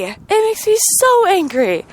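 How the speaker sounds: anger settling down; lingering real anger; still angry, a touch calmer. Real, not acted.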